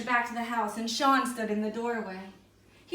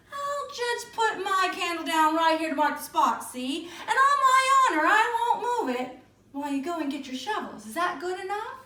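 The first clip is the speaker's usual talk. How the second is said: higher, louder, sly little man; sly leprechaun; 7 semitones higher, 4 dB louder than usual. A narrator's reading. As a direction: sell the trick with false honesty. Leprechaun sets up his trick.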